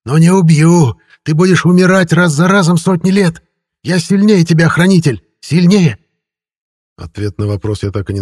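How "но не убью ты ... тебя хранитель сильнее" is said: high, loud raving creature; frenzied high villain shouting; yelling. A narrator's reading. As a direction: rave in triumph at the top of the voice. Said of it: "вопила сущность".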